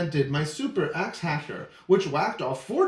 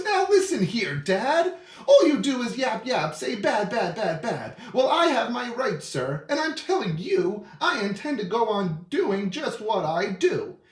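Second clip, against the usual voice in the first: yelling; 4 semitones higher than usual. Acted. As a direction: yell back at him. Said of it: "I yelled at the Lorax".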